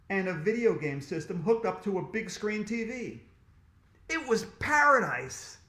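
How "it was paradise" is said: high, loud, thrilled exclamation; awestruck delight. A narrator's reading. Boy finds toys and video games, thrilled. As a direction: let PARADISE burst out with delight.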